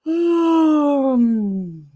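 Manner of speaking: high open vowel sliding down into a hum; warm-up hum. Shown as a demonstration. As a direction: slide down and close to a hum. Demonstrates sustained warm-up sound.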